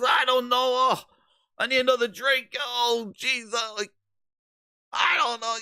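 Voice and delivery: put-on high-pitched, sloppy drunk rambling climbing to a yell; drunk slurring. Drunk boss slurring answers to an interviewer at the bar. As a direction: play a sloshed exec babbling at the bar. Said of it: "slurring his words".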